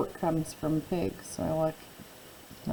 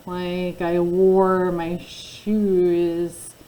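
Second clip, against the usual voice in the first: slow teaching voice; 4 dB louder, much slower than usual. Shown as a demonstration. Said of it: "The next word is war".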